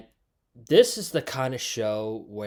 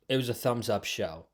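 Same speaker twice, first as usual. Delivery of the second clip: ordinary talk, nothing marked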